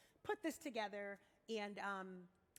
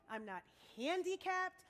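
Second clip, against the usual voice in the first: ordinary delivery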